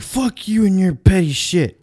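high, loud, slow mocking snarl of the rude guy; hammed-up drunk taunt, voice pushed up high; mock aggressive drunk. Retelling the rude ex-dishwasher's slow, high taunt at the bar.

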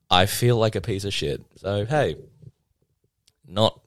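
usual speaking voice

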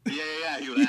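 normal talking voice

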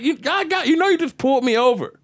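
high-pitched pleading argument; exasperated arguing. Retold or imitated. Driver argues with cop after repeat stop, high exasperation.

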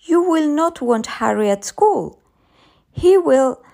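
high, emphatic arguing; insistent mother. A narrator's reading. Mother arguing with her child.

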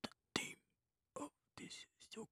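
hushed, mock Japanese accent. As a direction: put on a halting Japanese accent, very softly. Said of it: "speak with the Japanese ... accent".

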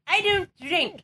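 imitated Irish granny, insisting; Irish-accented grandmother. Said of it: "an Irish accent".

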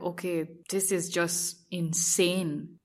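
soft and very slow, in disbelief; stunned awe. Retold or imitated. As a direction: let it out under your breath, amazed. Stunned after the runway walk.

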